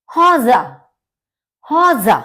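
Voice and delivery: हौजा said twice, final e as a; German word demo. Shown as a demonstration. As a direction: model हौजा loud and clear for learners. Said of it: "आ की तरह से pronounce करते हैं". (हौजा का German उच्चारण, ज़ोर से)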